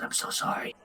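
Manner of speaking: hushed, secretive whisper; soft, breath-only apology. Acted. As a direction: whisper it so only one person hears. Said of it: "she whispers to you".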